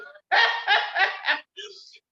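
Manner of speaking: a loud, high burst of laughter; hearty laugh. Real, not acted. Vlogger laughing at her own question, loud burst of laughter. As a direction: crack up at the joke.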